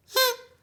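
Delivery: big theatrical gasp, high and loud; loud storyteller gasp; dramatic gasp. Acted. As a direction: gasp in mock shock for the kids.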